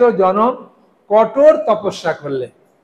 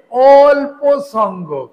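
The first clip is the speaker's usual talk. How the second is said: "অল্প" stretched long and loud, pitched up; slow, higher, weighty stress on "অল্প"; emphatic; 5 semitones higher, 4 dB louder, much slower than usual. Real, not acted. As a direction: draw out "অল্প" slowly for the listeners to catch. Stresses key phrase for listeners.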